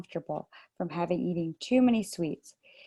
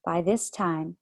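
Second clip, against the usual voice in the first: plain reading voice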